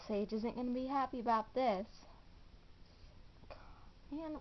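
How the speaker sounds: normal talking voice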